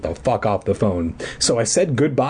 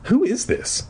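mother's voice lifted high, tired and tense; a weary, wary mother; 11 semitones higher than usual. Retold or imitated. Mother on the phone, tired and tense. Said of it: "She sounded tired and tense".

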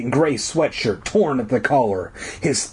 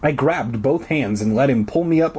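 plain reading voice

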